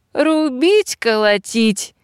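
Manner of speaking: high-pitched rallying cry at moderate volume. A narrator's reading. Robber woman rushing to hug her friend, crying out. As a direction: exclaim it high, starting at the top. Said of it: "закричала она".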